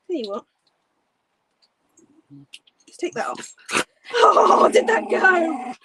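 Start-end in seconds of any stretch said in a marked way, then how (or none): 4.1-5.7 sudden loud high exclamation; surprised yelp. Real, not acted. Streamer startled mid-stream, sudden loud high yelp. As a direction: jolt up in surprise.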